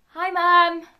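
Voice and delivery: loud, high, put-on call; forced greeting. Villain waving to mum on the news, forced loud greeting. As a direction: call out to mum on camera, under duress.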